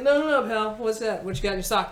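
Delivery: put-on a security guard calling out a kid; mock stern security guard; guard's challenge. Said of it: "the security guard saw you and was like".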